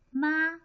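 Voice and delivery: MA held high and level; first tone. Shown as a demonstration. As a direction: keep MA on one high steady pitch. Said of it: "look at the example of tone".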